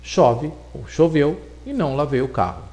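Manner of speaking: ordinary delivery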